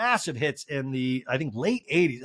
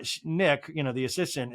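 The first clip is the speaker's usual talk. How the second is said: normal talking voice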